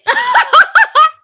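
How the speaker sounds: high, loud cackle of laughter; burst of laughter. Real, not acted. Fan laughing hard at the son of Satan line, big laugh.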